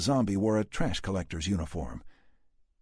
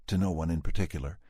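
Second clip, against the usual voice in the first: plain reading voice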